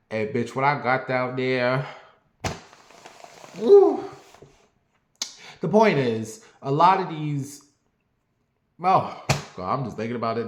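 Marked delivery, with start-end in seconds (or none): 3.6-4.0 high loud whoop of delight; excited whoop. Real, not acted. Teller remembers the encounter, loud excited woo. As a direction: let out a big high woo. Reacting to memory.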